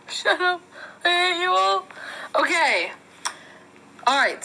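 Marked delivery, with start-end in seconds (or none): 0.1-1.8 sulky high toy voice. Pretend. Toy sulks after mockery.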